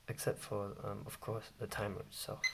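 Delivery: ordinary talk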